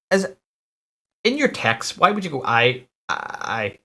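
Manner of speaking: high incredulous question at a strange message; baffled disbelief. Real, not acted. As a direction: pitch jumps up in confusion. Baffled by text wording.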